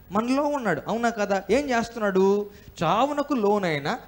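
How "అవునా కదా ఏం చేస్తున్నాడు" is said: ordinary talk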